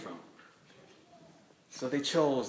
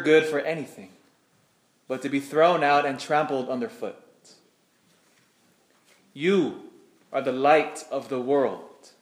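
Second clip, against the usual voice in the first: scripture reading. Acted. Reads Gospel aloud at Mass.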